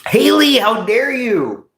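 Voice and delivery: high, slow mock offense; mock outrage. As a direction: play the insulted diva.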